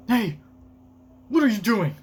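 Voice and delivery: high, loud acted call; alarmed shout. Reads alarmed shout from manga.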